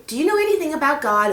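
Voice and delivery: put-on girl Mary. Mary asks the snake for help, eager. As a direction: ask sweetly and eagerly.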